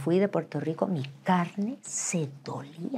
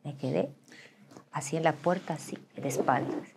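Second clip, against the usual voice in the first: ordinary delivery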